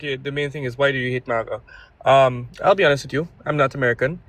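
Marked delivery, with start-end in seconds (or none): none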